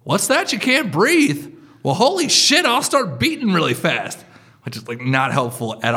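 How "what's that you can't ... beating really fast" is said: high, loud cartoonish alarm; panicking heart's voice. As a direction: play an overexcited heart freaking out. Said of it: "my heart catches wind of the fact".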